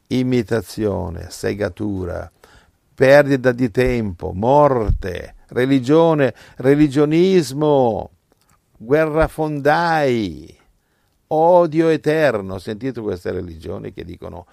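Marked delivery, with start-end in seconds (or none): 2.9-12.5 higher, louder condemning list; fiery preaching. Acted. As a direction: hammer each word in scorn. Preaching climax. (elenco sprezzante, più alto e forte)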